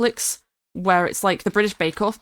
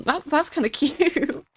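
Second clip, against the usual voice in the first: higher, slow, laughing through the words; amused laughing; 7 semitones higher, slower than usual. Real, not acted. Streamer tickled by the red wagon story, laughing. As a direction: let a real laugh break into it.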